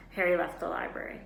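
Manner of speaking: ordinary delivery, nothing marked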